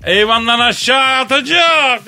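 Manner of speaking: high, slow alarmed cry; panicked warning. Retold or imitated. Brutus warns Kadir that Caesar will jump, in high alarm. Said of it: "demesiyle biz fırladık".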